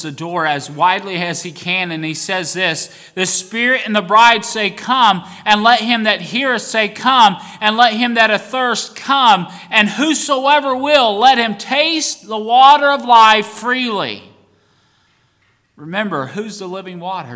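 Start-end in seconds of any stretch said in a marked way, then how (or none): 3.1-14.2 louder, higher, broad; proclaimed invitation. Real, not acted. Preacher calling everyone to come, proclaiming loudly. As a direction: call it out like an open door.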